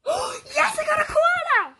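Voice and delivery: high gasping cry of joy; excited shriek. Real, not acted. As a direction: scream it, overjoyed. Wins the koala she wanted.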